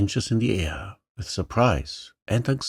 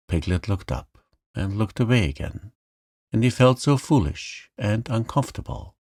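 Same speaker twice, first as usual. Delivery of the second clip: ordinary delivery, nothing marked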